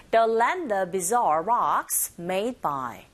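slow model with buzzing z in "bizarre"; teacher slowly models the phrase; slow drill line. Shown as a demonstration. Teacher drills the phrase slowly for students to repeat. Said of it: "listening two".

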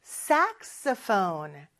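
"saxophone" slow with a clear rise and fall in pitch; slow, tuned saxophone; saxophone melody. Shown as a demonstration. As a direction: sing the pitch contour of "saxophone". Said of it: "이렇게 높낮이가 있어요".

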